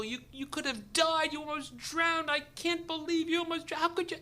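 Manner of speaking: high, wailing imitation of inconsolable wife; comic retold sobbing complaint; mock crying. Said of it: "she's crying, she's inconsolable".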